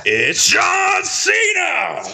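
high booming announcer yell; wrestling announcer shout. Acted. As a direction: bellow it like a ring announcer.

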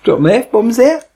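high, drawn-out mock voice; mock high, slow quote. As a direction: drawl it up high, teasing. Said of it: "that was a bit like".

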